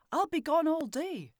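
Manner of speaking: dad announcing he's off for the day; dad's voice. A narrator's reading. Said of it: "he told them".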